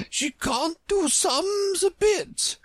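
very high, slow, emphatic queens; great emphasis. A narrator's reading. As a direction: drag out "She can't do sums a bit" with weight on each word. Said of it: "with great emphasis".

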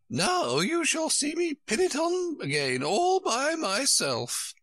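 high, slow, proud queenly announcement; triumphant. A narrator's reading. Queen showing Alice she can pin it, triumphant. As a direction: crow it high, drawing out the words. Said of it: "in a triumphant tone".

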